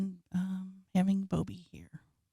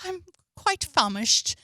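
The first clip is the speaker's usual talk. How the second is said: put-on English orphan accent; 10 semitones higher than usual. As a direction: plead politely as a Cockney waif. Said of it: "give me a little sample?".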